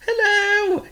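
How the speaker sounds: excited bird shout. Acted. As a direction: call across the woods. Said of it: "shouted Chirp".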